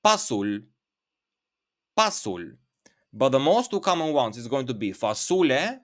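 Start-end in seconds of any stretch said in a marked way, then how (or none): 0.0-2.5 pasul, said lower with a clear P; word demo. Shown as a demonstration. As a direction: stress the P sound in pasul. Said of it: "but is with a P".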